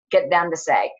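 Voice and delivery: normal talking voice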